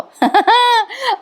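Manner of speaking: high burst of real laughter; a sudden high laugh. Real, not acted. Laughs at Sayali's bribe.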